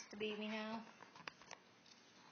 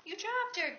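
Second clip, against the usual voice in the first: gasp, then slow and higher; surprised gasp; 8 semitones higher, 6 dB louder, slower than usual. Real, not acted. Parent gasps at toddler dropping doll, surprised. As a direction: caught off guard as the doll falls.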